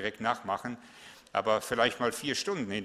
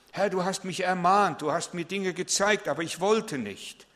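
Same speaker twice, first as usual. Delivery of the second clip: raised, higher call to turn around; fervent preaching; 6 semitones higher, 3 dB louder than usual. Acted. Pastor calling the congregation to repent, fervent preaching. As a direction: preach it out with rising urgency.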